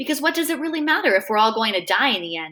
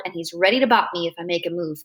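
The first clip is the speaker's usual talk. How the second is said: ordinary delivery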